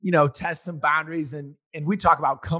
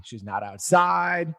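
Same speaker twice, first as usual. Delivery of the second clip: ordinary talk, nothing marked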